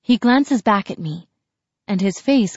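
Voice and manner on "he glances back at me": plain reading voice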